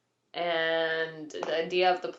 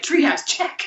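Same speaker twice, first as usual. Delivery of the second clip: high, loud, punchy announcement; triumphant text; 8 semitones higher, 6 dB louder, slower than usual. Retold or imitated. Mom relaying his text about the treehouse, triumphant. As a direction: call it out like ticking off a win. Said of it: "he texted me, he's like".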